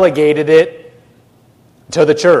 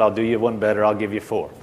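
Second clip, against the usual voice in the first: softer, lower, gentle reply; sweet, unangered; 6 semitones lower, 6 dB quieter, faster than usual. Retold or imitated. Jesus showing he wasn't mad, soft sweet tone. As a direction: make the generous offer quietly and kindly. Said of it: "he smiled real sweetly and said".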